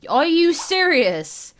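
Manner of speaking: slow, high, loud are you serious; indignant teen can't believe it; outraged disbelief. Acted. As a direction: drag it out in shock. Son outraged by mother's plan.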